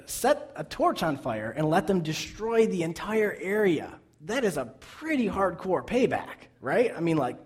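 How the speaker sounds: ordinary delivery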